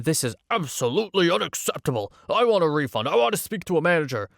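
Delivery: high, indignant mock shout; angry shouting dad. Dad berating the ride loader, angry shouting. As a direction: rant like an outraged parent demanding satisfaction. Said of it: "starts shouting at my friend".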